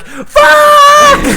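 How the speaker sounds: very high, loud comic shriek; hammed-up piercing yell; internal scream. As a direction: explode in a drawn-out inner panic scream. Ginger's internal outburst after freezing.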